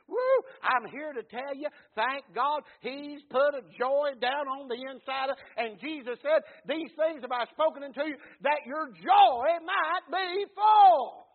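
whoop, then loud high preaching building to a held shout; full-force preaching. Real, not acted. Preacher brings the congregation a word on joy, full-force preaching.

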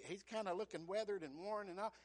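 normal talking voice

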